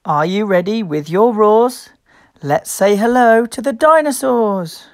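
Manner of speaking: usual speaking voice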